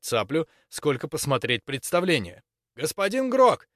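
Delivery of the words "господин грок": high, loud call; shouting. Acted. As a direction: call out to get his attention. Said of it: "крикнул Максимилиан".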